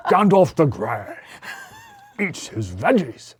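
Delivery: hammed-up English wizard voice; mock-theatrical English accent; Gandalf. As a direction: play a grand old wizard for laughs. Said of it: "I'm going to talk like Gandalf".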